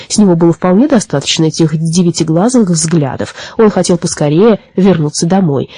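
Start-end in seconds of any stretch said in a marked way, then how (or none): none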